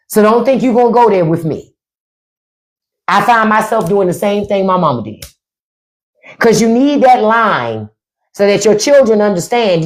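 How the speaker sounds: normal talking voice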